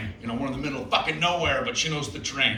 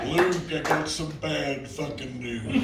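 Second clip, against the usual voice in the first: slow, drawling Nicholson as Darth Vader; Jack Nicholson; slower than usual. Pretend. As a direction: lean on it like Nicholson breaking bad news, slowly. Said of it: "This is Jack Nicholson's audition for Darth Vader".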